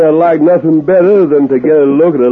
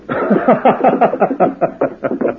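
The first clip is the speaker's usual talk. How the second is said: mocking cackle; 5 semitones higher, 5 dB quieter than usual. Stranger cackles at Happy Morgan's name, mocking laugh. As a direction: cackle to mock the gang leader.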